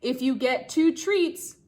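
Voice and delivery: lively storybook reading for a class; reading to children. Acted. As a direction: read the rhyme aloud to the kids, bouncy. Teacher reads picture book aloud.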